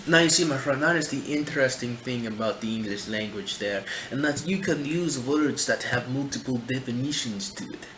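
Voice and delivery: thick put-on Russian accent. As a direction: slip into a mock Russian accent. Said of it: "I'm trying to do a Russian accent".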